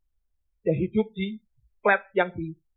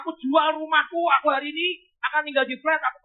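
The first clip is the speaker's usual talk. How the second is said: mild mock shout; 8 semitones higher than usual. Man offers his house.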